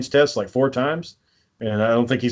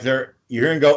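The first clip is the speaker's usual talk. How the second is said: ordinary talk, nothing marked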